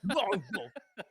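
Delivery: laughing mock curse.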